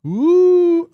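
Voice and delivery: high, loud ghostly wail; a mock villain's eerie hoot; hammed-up spooky oooh. Ending his villain bit with a high loud mock-spooky ooh.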